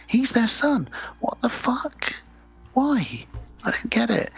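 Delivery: high-pitched, incredulous outburst; baffled disbelief. Real, not acted. Host reacting to the vampire boy being their son, incredulous. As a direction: pitch way up, bewildered by the nonsense. Baffled at absurd plot twist.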